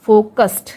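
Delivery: फोकस्ड pronounced "fokast"; final D as T. Shown as a demonstration. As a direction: end फोकस्ड on s plus voiceless t. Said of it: "डी का sound ट होगा". (फोकस्ड को फोकस्ट बोलकर)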